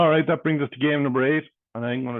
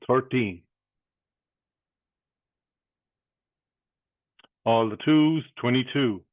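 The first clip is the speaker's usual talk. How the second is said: bingo caller. Acted. Calls bingo numbers.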